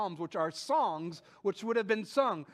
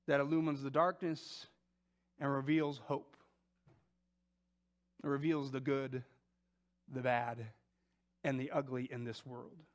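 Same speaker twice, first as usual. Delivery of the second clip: tender; 7 semitones lower, 3 dB quieter than usual. Acted. Intimate paraphrase of Jesus.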